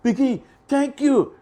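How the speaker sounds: high and loud, patronising; indulgent crowd. Retold or imitated. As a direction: coo at him like a child, then press. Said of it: "They say".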